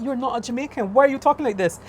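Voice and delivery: high-pitched, baffled questioning; exasperated, high-pitched disbelief; incredulous challenge. Retold or imitated. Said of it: "I'm like".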